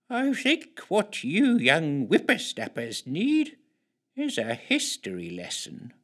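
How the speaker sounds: put-on slow, soft elderly woman; soft old lady. A narrator's reading. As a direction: speak slowly like an old woman. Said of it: "adding softly".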